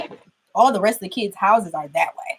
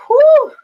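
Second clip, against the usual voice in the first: relieved whoo; 9 dB louder than usual. Real, not acted. Relief after the scare.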